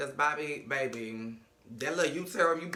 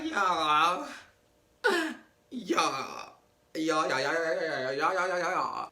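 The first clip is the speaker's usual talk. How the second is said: stammering Joy; much slower than usual. Retold or imitated. Joy stammering news to Trina.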